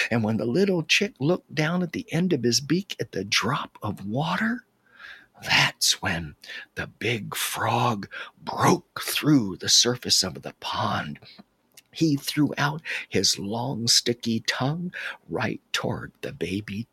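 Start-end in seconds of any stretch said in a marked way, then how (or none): none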